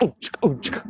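high "ooh", short voiceless "chick"; imitated throw-and-trap noises. Shown as a demonstration. Juggling teacher voicing throws and a trap, imitated noises. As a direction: sound out the moves as rhythmic noises.

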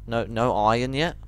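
ordinary delivery

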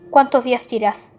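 normal talking voice

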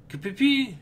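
high chirpy fly noise; fly chirp. Acted. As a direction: squeak it like a tiny fly.

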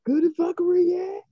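high-pitched show announcer; excited, rising intro voice; hyped segment intro. Acted. Hypes the show's segment intro.

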